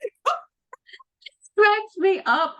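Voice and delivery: usual speaking voice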